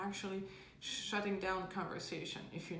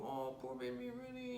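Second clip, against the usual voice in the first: put-on soothing a child; 4 semitones higher, 4 dB quieter than usual. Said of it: "and you go".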